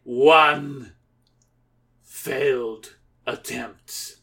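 slow, drawn-out count. Acted. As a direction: count the failure with relish.

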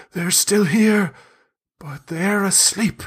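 high, slow, hushed secret-telling; scared conspiratorial. Acted. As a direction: lean in wide-eyed with a fearful secret. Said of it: "conspiratorially, but still, still eyes wide".

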